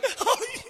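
high, soft mock sobbing wail; hammed-up weepy "ay". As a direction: fake a whimpering cry up high. Imitates members crying as they leave.

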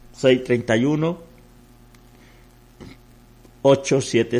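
slow, measured digits with long pauses between groups; phone number read-out. Real, not acted. Pastor giving listeners the offering number, slow measured read-out. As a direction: read each digit slowly for people to copy.

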